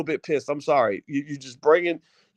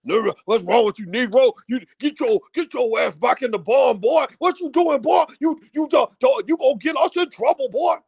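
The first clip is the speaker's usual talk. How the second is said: higher, hammed-up master barking at a slave boy; mock plantation master; 6 semitones higher, 3 dB louder than usual. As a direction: play the overseer ordering him back to the barn. Acted plantation master scolding a slave.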